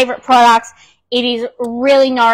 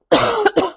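cough; 7 semitones higher than usual. Real, not acted. Coughing mid tutorial.

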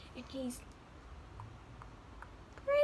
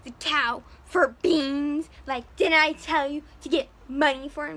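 loud, slow outraged scolding; furious mom; 12 dB louder than usual. Retold or imitated. Mother scolding Jack over the beans, loud outrage. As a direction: blast him for trading the cow. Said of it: "the mother is like".

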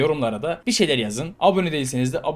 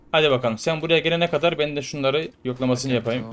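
usual speaking voice

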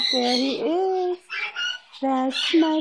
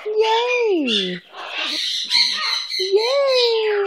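excited cheer; 9 semitones higher than usual. Real, not acted. Cheering with pet bird.

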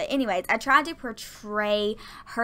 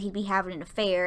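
ordinary talk

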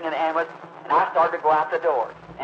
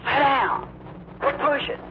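hollering crowd; 11 semitones higher, slower than usual. Retold or imitated. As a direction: yell at the man pushing through. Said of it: "everybody hollered".

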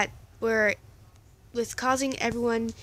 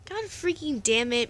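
ordinary delivery, nothing marked

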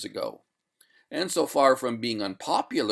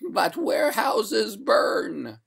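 high, slow wailing objection; protesting ghost; 11 semitones higher, 4 dB louder, much slower than usual. A narrator's reading. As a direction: wail it out, drawn long. Ghost protests her icy fate.

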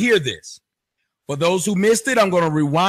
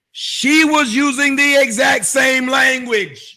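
raised, slow, incredulous emphasis; emphatic indignation; 4 semitones higher, 3 dB louder than usual. Real, not acted. Host pointing out the psychologist's hypocrisy to listeners, indignant emphasis. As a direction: drive each word home, high and slow.

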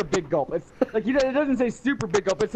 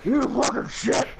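fat man voice; 3 semitones higher, slower than usual. Pretend. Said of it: "he's gotta sound better".

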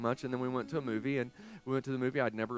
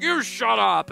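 loud, high retort; drunken yelling back; 10 semitones higher, 12 dB louder, slower than usual. Retold or imitated. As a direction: imitate a belligerent drunk shouting back. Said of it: "he's yelling".

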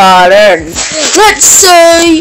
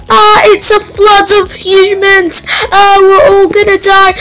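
mock panic; 6 semitones higher than usual. Mock panic at customer flood.

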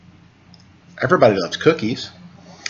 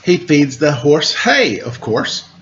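put-on reading to children. A narrator's reading. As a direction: read the rhyme aloud to little listeners. Reads picture book to children.

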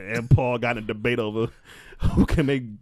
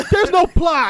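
laughing outburst; 4 dB louder, slower than usual. Real, not acted.

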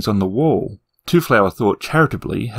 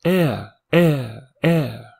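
echo; 3 semitones higher, much slower than usual. Acted. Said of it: "replied the dark tunnels".

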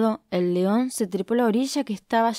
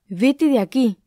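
high, loud startled shriek; the boar shrieking at the lion; 5 semitones higher, 5 dB louder than usual. Acted. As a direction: shoo him off with a yelp. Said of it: "chilló el jabalí".

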